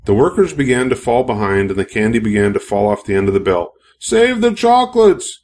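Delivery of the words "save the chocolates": high, slow urgent cry; frantic yell, much higher and drawn out. A narrator's reading. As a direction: shout it in alarm across the factory.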